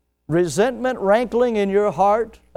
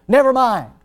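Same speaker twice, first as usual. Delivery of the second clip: loud, higher, snapped dismissal; curt brush-off; 7 semitones higher, 8 dB louder than usual. Retold or imitated. Offended friend brushing off the question, loud and curt. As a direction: cut him off hard.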